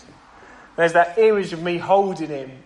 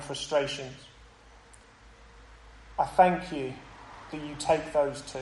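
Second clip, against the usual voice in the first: closing prayer. Acted. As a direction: pray gently for the congregation.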